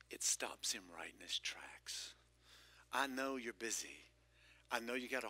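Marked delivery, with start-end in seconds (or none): 0.1-2.1 low, slow, hushed whisper. Real, not acted. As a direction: drop the voice to a slow hush. Preacher drops to a hush.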